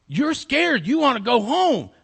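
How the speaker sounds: loud, high-pitched emphatic questioning; big fellow, acted loud; big man's voice. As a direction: call it out to a scared child. Big man questions frightened girl.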